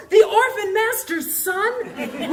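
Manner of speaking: high, slow, laughing disbelief; thrilled recognition. Retold or imitated. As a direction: stretch it out in starstruck delight. Recognizing the author's book.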